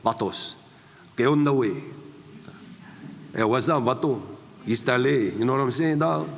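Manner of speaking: lightly voiced Chicano gang-banger; Chicano gangster voice. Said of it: "I was playing, you know, vatos".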